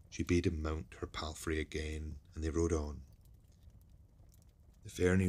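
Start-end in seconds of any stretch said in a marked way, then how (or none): none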